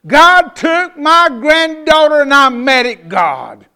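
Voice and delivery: high, loud, slow wail of anger; furious grief. Retold or imitated. Grandmother raging at God in the funeral line, loud wail. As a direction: hurl it at God through grief.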